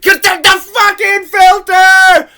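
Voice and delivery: loud, high, furious yelling; shouting in disbelief at the movie; outraged shout. Real, not acted. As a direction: rant at the screen about the filter. Ranting about the snow filter.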